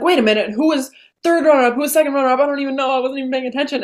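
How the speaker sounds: lost and bewildered, voice up; realizing she missed the results, bewildered; mock confused. Confused backstage.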